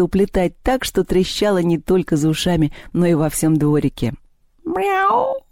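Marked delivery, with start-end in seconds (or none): 4.6-5.4 high, stretched meow; cat's meow. A narrator's reading. Vaska meows after licking the bowl clean, cat's meow. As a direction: meow like a contented cat. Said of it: "сказал Васька".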